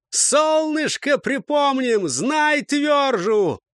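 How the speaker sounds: high, drawn-out battle cry; rallying yell. A narrator's reading. Светил rallies his squad for the charge, yelling. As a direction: holler it slowly to fire up the squad. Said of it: "заорал Светил".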